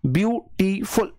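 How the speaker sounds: Beautiful said as view, टी, फुल with gaps; pauses between syllables. Shown as a demonstration. Teacher showing students where the pauses fall, beautiful in separated syllables. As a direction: split beautiful into three separate beats. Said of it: "कहीं ना कहीं मैं pause ले रहा हूं".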